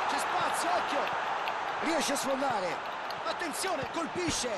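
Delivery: high urgent play-by-play; rising alarm at the chance; excited commentary. Acted.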